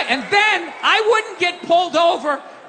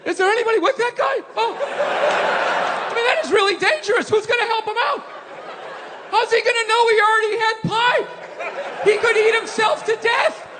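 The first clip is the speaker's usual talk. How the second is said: higher, laughing worried fuss over a stranger; concerned pity; 6 semitones higher than usual. Retold or imitated. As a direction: fret over the lonely old man. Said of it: "going like".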